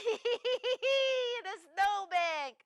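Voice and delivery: slow, laughing repeat. Acted. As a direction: savor the punchline with a laugh.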